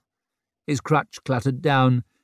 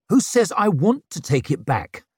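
higher angry snarl; 7 semitones higher, 4 dB louder than usual. Acted. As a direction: bite the words off in anger. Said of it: "he snarled".

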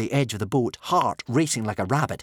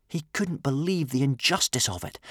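ordinary delivery, nothing marked

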